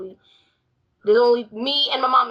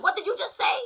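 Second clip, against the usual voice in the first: mock shocked mom; 9 semitones higher than usual. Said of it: "She was like".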